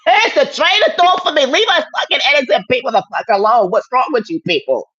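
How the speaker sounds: high-pitched, loud, exasperated rant; shrieking outburst. Real, not acted. Ranting high and loud at online haters. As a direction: let it fly up high and loud at them.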